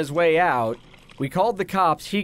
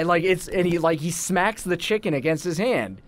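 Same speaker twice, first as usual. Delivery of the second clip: ordinary talk, nothing marked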